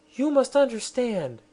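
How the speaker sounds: high, lofty royal voice; a queen lecturing her captives; haughty queen. Acted. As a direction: speak down to them from the throne.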